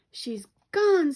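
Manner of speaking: high, loud gleeful scream. A narrator's reading. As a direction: shriek it with wild glee. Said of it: "screamed the twins".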